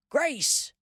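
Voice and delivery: high mock shout of a name. A narrator's reading. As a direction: call her name out loud. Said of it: "Pa shouted too".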